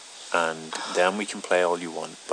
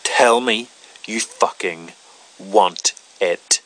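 angry demand; 2 semitones higher, 7 dB louder, slower than usual. Acted. Loud slow demand.

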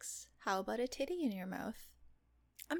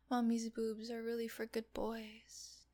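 normal talking voice

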